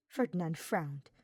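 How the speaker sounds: ordinary delivery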